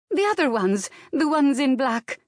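put-on high, loud Slavic-accented answer; urgent Slavic accent. A narrator's reading. Maid telling employer who came, urgent Slavic accent. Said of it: "her accent growing stronger".

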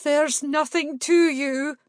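shaken. A narrator's reading. Salamander shaken.

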